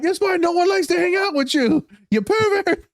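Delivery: very high-pitched indignant rebuke; mock outraged scolding. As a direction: imitate outraged passengers telling you off. Passengers scolding him, retold.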